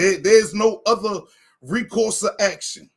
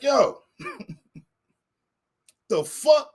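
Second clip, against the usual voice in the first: getting loud; 6 semitones higher, slower than usual. Retold or imitated. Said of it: "Now I'm getting loud".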